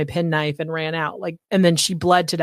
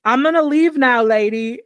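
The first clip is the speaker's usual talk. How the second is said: lightly voiced killer; casual threatening exit; mock menacing; 6 semitones higher, 4 dB louder than usual. Killer quoted leaving.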